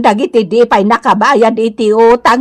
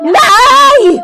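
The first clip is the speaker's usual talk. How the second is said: shout; 10 semitones higher than usual. Acted. Shouting outburst.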